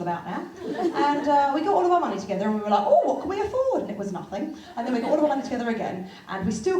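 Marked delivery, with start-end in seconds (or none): 2.9-3.8 hopeful. Retold or imitated. Couple asking hopefully.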